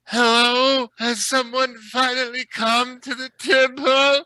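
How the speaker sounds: put-on high, loud drunk voice; drunk Gold Palm. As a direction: slur it out, way up high. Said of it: "very drunk Gold Palm Quinn Silverfinger being like".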